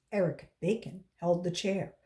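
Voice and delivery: plain reading voice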